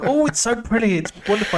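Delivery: high-pitched cooing delight; mock gushing. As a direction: gush with put-on wonder. Acts out admiring the snow.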